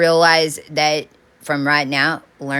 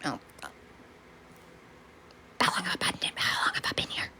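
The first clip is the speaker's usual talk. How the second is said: softer unvoiced whisper of confusion; dazed whisper; 7 semitones lower, 14 dB quieter, faster than usual. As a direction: whisper as if just waking, lost. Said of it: "We're like".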